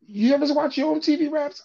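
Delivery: high, eager imitation; eager questioner. TV worker questioning a DJ mid-party, eager. Retold man pitching to busy DJ.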